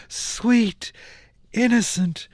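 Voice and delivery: normal talking voice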